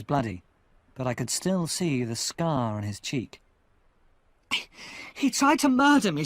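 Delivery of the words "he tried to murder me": high, loud outburst; loud accusation. Acted. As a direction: blurt it out in alarm. Convict accuses the other.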